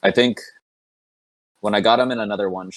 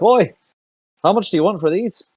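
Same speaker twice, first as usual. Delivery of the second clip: calling out; 12 semitones higher than usual. Acted. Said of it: "call out to the shopkeep".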